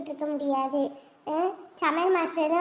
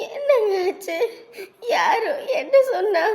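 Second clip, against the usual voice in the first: tearful worry; 3 semitones higher than usual. Acted. Wife asks crying husband, distressed.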